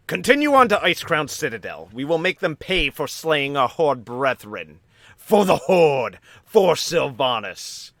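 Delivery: high, loud battle cry, slowing at the end; rallying commander. Acted. Lorthamar rallying the ship's crew, loud battle cry. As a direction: rouse the troops like an elf lord. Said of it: "he looks at both of you and goes".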